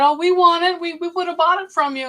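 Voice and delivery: eager. Retold or imitated. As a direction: mimic the workers eagerly asking to buy it. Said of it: "And they said".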